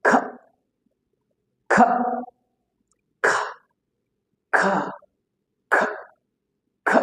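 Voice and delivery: short, aspirated "খ", each one separate; isolated K sound. Shown as a demonstration. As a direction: puff out "খ" briefly, pausing after each. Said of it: "সাউন্ডটা কিভাবে হবে?". (ছোট করে আলাদা আলাদা "খ" ধ্বনি)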